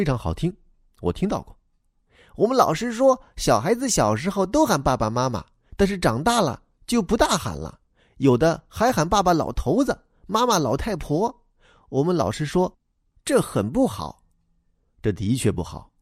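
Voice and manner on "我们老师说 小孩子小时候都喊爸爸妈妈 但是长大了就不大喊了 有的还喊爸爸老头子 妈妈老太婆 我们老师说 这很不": put-on high, childlike voice for the little boy; young boy replying to the grown-up, high and eager; boy's voice. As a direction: play the earnest schoolboy explaining himself. Boy quotes his teacher.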